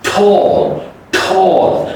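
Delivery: "tall" high, puffed T and long aw; crisp T. Shown as a demonstration. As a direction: lift and stretch "tall" after the T. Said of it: "then there's a T".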